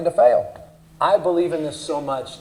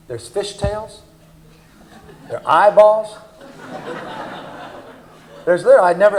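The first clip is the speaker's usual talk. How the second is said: hammed-up disbelief; 7 semitones higher than usual. Engineer reporting fish parts to the boss, mock aghast.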